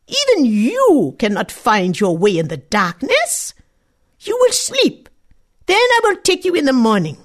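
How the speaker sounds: put-on a strange forest mother judging the girl; stern old woman. The old forest woman scolding a selfish girl, high and stern.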